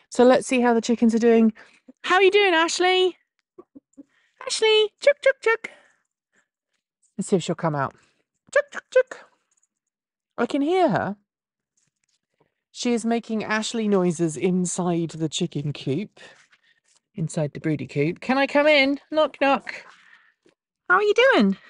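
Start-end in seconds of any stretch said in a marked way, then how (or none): 2.0-5.7 high sing-song chicken call. Real, not acted. Coaxing the hen out. 8.5-9.3 high sing-song chicken call. Real, not acted. Calling the hen again. 18.2-21.6 high sweet voice for a hen; calling chickens. Real, not acted. Greeting the hen at coop.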